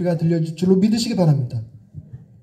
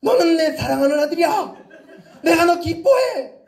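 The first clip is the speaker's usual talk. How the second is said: scolding tone; 7 semitones higher than usual. Shown as a demonstration. Preacher jokingly scolding a boy named Hoseon. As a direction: bark the blessing like a mock telling-off. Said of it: "그 야단치는 톤으로".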